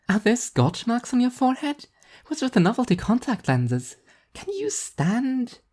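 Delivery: put-on high, anxious young woman fussing over her; a worried friend checking for injuries; high concerned young woman's voice; high-voiced Emilia. A narrator's reading.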